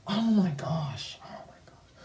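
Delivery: soft unvoiced whisper, trailing off to silence. Real, not acted. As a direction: whisper it in cringing disbelief. Embarrassed by old drawing.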